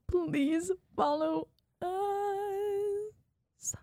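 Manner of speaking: high, very slow begging whine; high drawn-out plea. Acted. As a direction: stretch it into a squeaky plea. Begging listeners to follow.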